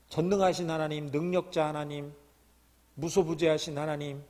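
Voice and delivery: ordinary talk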